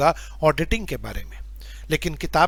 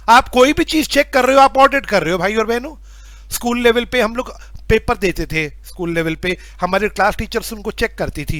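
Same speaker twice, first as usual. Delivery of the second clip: normal talking voice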